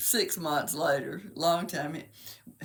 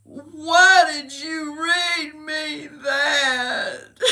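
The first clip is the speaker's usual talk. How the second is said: hammed-up high, loud, drawn-out crying; mock child's wail; 9 semitones higher, 6 dB louder, much slower than usual. Imitating her son upset at the poem, wailing. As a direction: wail it very slowly like a heartbroken little boy. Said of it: "he looked at me and he goes".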